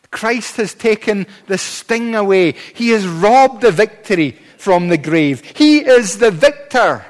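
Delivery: loud, rising pulpit proclamation; triumphant preaching. Acted. Preacher proclaiming Christ's victory over the grave, triumphant preaching. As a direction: build to a ringing declaration of victory.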